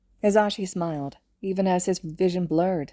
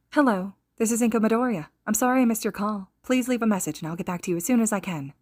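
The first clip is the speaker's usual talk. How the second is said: a higher, brisk recorded answering-machine voice; voicemail greeting; 5 semitones higher, faster than usual. Acted. Inko's recorded greeting to a caller, higher and brisk. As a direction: sound like a recorded phone greeting, quick at the end.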